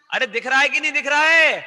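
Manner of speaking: high, loud demand for a reply; insistent shout. Real, not acted. Teacher checking all pupils can see, insistent shout. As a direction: shout the question across the class. Repeats question shouting for response.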